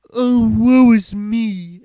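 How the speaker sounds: high, slow whiny wail of self-pity; exaggerated sad-singer parody; mock mopey singer. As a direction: ham up a woeful lament. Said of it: "It comes across as very".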